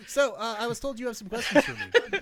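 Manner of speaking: high, loud nerdy voice with laughter; nerdy engineer voice. Acted. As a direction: play the awkward nerd, laughing. Engineer voice addresses Yondello.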